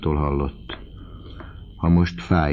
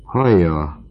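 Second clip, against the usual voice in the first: raised-voice call to the student; 3 semitones higher, 6 dB louder, slower than usual. A narrator's reading. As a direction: call him to attention, a little loud. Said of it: "kiáltott Vauquéné".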